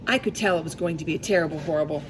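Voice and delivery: usual speaking voice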